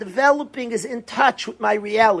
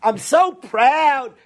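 higher, slow, syrupy mock pride; cheesy mock praise; 7 semitones higher, slower than usual. Mocking a hollow speaker to mourners, slow and higher. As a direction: drag out the so, mocking a cheap compliment. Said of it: "It's like the cheap thing to say".

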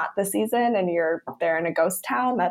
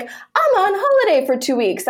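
breezy high holiday voice; 11 semitones higher, 3 dB louder than usual. Retold or imitated. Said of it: "They're all like".

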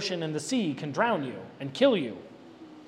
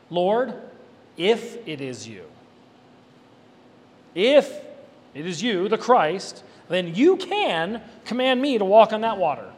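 Peter's bold request; 4 dB louder, slower than usual. Retold or imitated. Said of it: "So that's a little bit bold".

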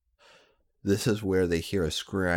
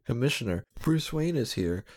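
higher, calling out to the back office; shouted; 6 semitones higher than usual. Acted. Fat cop calls the commissioner, shouting. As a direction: yell for the boss across the station. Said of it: "shouted the fat ass pork".

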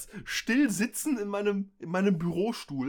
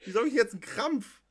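normal talking voice

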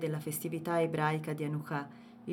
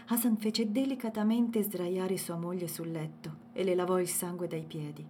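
plain reading voice

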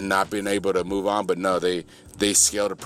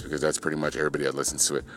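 fast aside; 6 semitones lower, 4 dB quieter, much faster than usual. Real, not acted. Joking aside about tiny audience.

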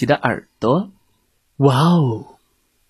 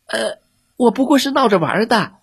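high, fast, nervous fox excuse; stammering fox; 10 semitones higher, faster than usual. A narrator's reading. Scared fox stammering to Billy that he was only playing. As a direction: babble the excuse out of fear. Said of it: "狐狸结结巴巴地说".

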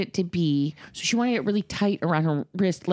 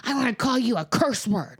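put-on mad child's threat; 5 semitones higher than usual.

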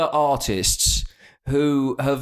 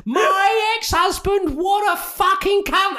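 [name] impression. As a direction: ham up a brash Cockney [name] voice. Mocking [name] in a Cockney impression.

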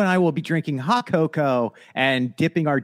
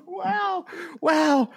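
high gasped wow; 12 semitones higher, 4 dB quieter than usual. Pretend. High mock gushing reaction.

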